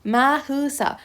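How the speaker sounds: "مهسا" as ma-heu-sa; added vowel. Shown as a demonstration. Teacher adding a letter to fix the name, extra vowel. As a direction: put a short eu after the h in "مهسا".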